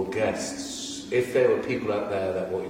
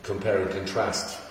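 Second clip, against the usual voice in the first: normal talking voice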